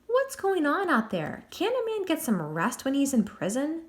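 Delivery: a disturbed English prisoner calling out the window; English accent. Acted. Jack woken in his cell, grumbling in an English accent. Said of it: "he shouted in a thick English accent".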